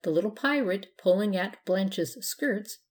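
usual speaking voice

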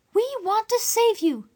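put-on high young girl, eager to help; a kind girl reassuring the guest; girl's voice. Dora reassuring the stranger, high and eager. Dora's voice.

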